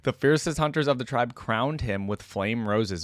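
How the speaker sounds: normal talking voice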